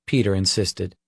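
ordinary delivery

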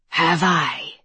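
a monster's rasping snarl; raspy monster snarl. A narrator's reading. Monster answering Harker, raspy snarl. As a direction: snarl it back with a rasp. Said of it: "snarled the monster, his voice a rasp".

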